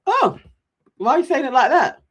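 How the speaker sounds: high, loud, puzzled question; taken aback. Retold or imitated. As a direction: react as if caught off guard. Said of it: "And he's like".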